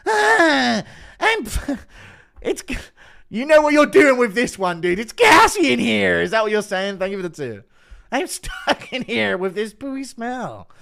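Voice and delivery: high, loud, strained mock Helwani voice stuck in a stinky restroom; Helwani impression. Streamer mocking Helwani in a stinky restroom, high squealing impression. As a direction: squeal it out like Helwani trapped with no toilet paper. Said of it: "Impression of Helwani running out of toilet paper".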